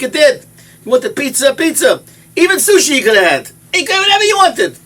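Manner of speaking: preacher's crescendo of wonder; rising exclamation. Real, not acted. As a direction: build it up to a shout. Preacher building to a climax.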